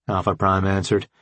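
plain reading voice

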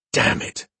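breathless hissed curse, unvoiced. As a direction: spit it out in a hiss. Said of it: "he hissed".

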